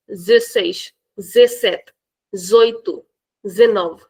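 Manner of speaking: zesseis, zessete, zoito, zenove said clipped; casual spoken clipping; dropped de. Shown as a demonstration. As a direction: swallow the de on each. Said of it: "They will not say dezenove".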